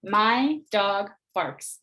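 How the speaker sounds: said slowly, word by word; slow spaced sentence. Shown as a demonstration. Teacher reading the sentence over the manipulatives, slowly. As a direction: point to each word as you say it. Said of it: "manipulatives to show".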